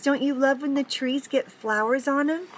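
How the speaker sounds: plain reading voice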